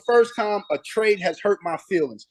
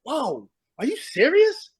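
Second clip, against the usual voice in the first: disbelief; 6 semitones higher, slower than usual. Retold or imitated. Retold disbelief at coach's words.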